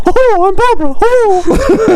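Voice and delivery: mocking a friend's attempt at a female voice; mock Barbara voice. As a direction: imitate a friend's shrill Barbara voice. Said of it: "trying to do Barbara's voice".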